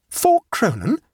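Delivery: high, incredulous question; outraged, high-pitched echo; shocked exclamation. A narrator's reading. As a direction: exclaim in disbelief at the price. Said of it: "exclaimed Abbleway".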